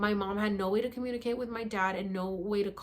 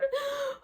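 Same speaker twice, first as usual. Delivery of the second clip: waking from sleep, gasping for breath; desperate gasp for air. Retold or imitated. Reenacting choking in sleep.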